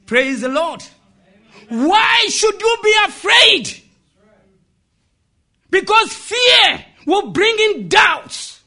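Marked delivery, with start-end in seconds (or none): 1.7-8.6 loud, high sermon delivery; full-force preacher; impassioned preaching. Real, not acted. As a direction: drive it home to the congregation, high and forceful.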